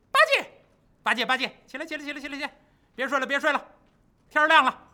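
urgent, high wake-up calls; calling to wake someone. Acted. Monkey King rousing Bajie at dawn, high calls. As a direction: shake a sleepy friend awake by calling.

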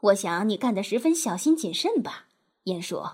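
ordinary delivery

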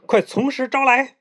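high-pitched commanding call; shouted demand. A narrator's reading. Monkey King confronts the pig demon, high commanding demand. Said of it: "孙悟空大喝一声".

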